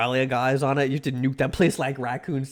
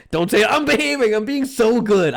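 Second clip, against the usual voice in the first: higher and louder, insisting; loud pleading; 7 semitones higher, 6 dB louder than usual. Real, not acted. Begging chat not to provoke, loud and high. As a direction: yelp it out protesting innocence.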